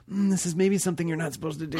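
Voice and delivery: hesitant inner warning, lightly done; gut feeling voice. Retold or imitated. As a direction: voice your gut as a cautious little warning, quick. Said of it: "I feel like".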